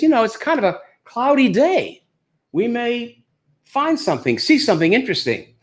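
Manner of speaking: a touch of the tentative tour guide; safari guide. Acted. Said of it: "our guide says".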